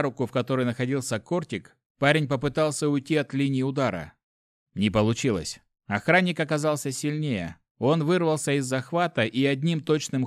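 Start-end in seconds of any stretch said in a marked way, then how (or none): none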